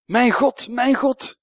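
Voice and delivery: high, loud anguished cry; Jesus on the cross crying out high and loud to God. Retold or imitated. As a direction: call out to God in despair. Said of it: "En Jezus roept".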